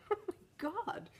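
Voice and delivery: high, slow laugh-through exclamation; laughing disbelief. Real, not acted. Recalling the laughing wildlife man, a high laughing exclamation.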